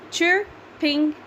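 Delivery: chirping in the trees spelled out slowly beat by beat; slow it down to tally the syllables; slow counted reading. Shown as a demonstration. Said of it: "that's five".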